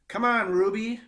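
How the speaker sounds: loud, high pleading call to the elephant. A narrator's reading. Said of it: "Mac is almost pleading".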